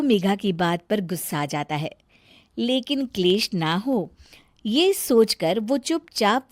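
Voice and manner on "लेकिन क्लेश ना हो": ordinary delivery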